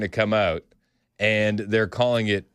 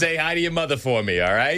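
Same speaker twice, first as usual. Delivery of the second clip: Boston accent; 6 semitones higher than usual. Shown as a demonstration. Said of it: "be thankful I'm not on here going".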